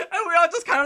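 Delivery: talking through loud laughter; laughing. Real, not acted. Laughing while telling the family's exit, loud.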